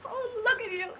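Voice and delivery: crying out, slow and choked; sobbing breakdown. Acted. Myra breaking down in front of Roy, sobbing. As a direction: let the tears break through every word.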